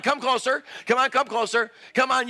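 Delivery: ordinary delivery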